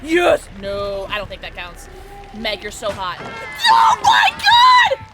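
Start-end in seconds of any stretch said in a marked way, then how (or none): 0.0-0.5 loud, high burst; real shout of victory; triumphant shout. Real, not acted. Celebrates getting the pallet. 3.2-5.1 very high, drawn-out shriek; real piercing stretched-out yell; shocked scream. Real, not acted. Screams at game event.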